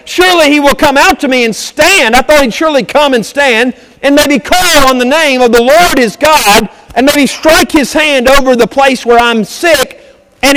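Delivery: loud, high, ringing preacher's voice; fired-up Sunday sermon cadence; preaching at full force. Acted. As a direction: preach it out to the whole congregation. Preaching Naaman's angry speech.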